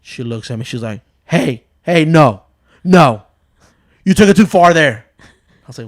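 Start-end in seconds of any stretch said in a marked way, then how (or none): 1.2-5.1 loud, raised mimic of an angry wife; retold anger. Wife defending her kids to husband, retold anger. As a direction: cut him off firmly, imitating her outrage. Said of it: "She got so mad".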